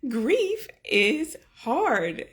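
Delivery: slow, high, close to tears; tearful and slow; choked-up. Real, not acted. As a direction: let it crack as you say it. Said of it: "about to start crying".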